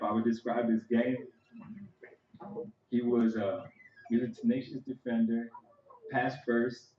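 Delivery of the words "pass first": normal talking voice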